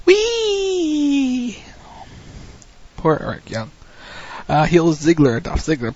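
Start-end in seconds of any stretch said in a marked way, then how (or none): 0.1-1.6 mock cheer. Sarcastic cheer over injured players.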